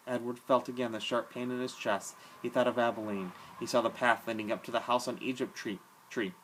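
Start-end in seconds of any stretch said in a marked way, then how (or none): none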